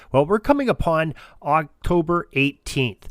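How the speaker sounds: ordinary delivery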